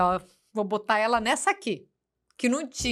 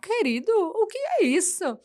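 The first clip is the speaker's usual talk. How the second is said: high, slow pleading to the son; mock-hurt mom; 10 semitones higher, slower than usual. Mother retelling her hurt reply to her son. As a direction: play the stung mother. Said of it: "E eu".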